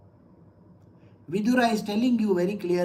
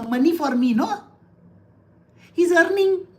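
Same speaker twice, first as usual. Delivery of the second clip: higher, pushed wife voice, smug about his earnings; mocking wife voice; 4 semitones higher than usual. Preacher mimics a wife counting on her husband's money, higher and mocking. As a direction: play the scheming wife sneering at the husband. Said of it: "she believes that that is her duty".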